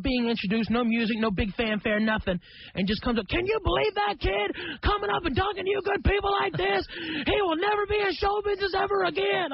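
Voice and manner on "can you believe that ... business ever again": hammed-up indignant shout at the crowd about the kid; mock outraged shout. Sam mocks the kid on stage.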